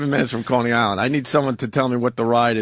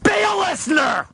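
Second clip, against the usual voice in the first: shouted scolding. Real, not acted. Host scolding a dummy who has no reason to participate, shouting. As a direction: belt it out sharply, high-pitched. Host berates an absent critic.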